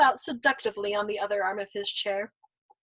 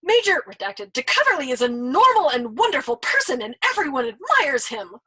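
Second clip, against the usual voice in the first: louder vehement scold; 4 semitones higher, 6 dB louder than usual. Acted. Nately scolding the old man, vehement. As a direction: scold him hard. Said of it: "he scolded vehemently".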